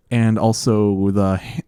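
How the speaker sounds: ordinary talk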